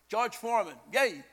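ordinary talk

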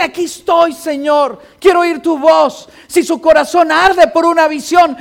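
loud, proclaiming preacher's cadence, hammering each repeated verb; preacher proclaiming to the congregation how his mother prayed, loud and fervent; preaching at full force. Acted. Preacher builds exhortation.

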